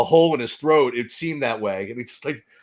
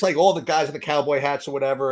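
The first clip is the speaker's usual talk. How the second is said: ordinary delivery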